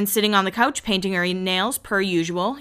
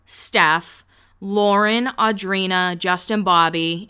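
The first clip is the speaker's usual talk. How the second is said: usual speaking voice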